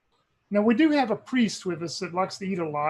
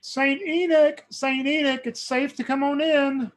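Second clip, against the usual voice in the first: calling out; 7 semitones higher, 4 dB louder, slower than usual. Acted. Adrian calls companion in.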